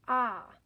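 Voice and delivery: a loud, open "Ah" for short O; short o sound. Shown as a demonstration. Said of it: "What's O say when they".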